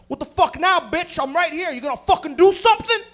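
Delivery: loud, high fighting taunt; aggressive challenge. Retold or imitated. Chest in roommate's face, loud aggressive challenge.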